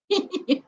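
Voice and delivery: short high laugh breaking out; real laugh. Real, not acted. A woman laughing at her own panic in the pool, real giggle. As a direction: laugh at the memory of herself.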